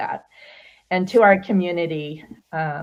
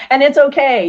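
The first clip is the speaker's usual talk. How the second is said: much higher and louder, still laughing; laughing through tears; 9 semitones higher, 10 dB louder than usual. Real, not acted. Host recovering after nearly crying, laughing. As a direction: push through the laugh to reassure. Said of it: "make me cry again".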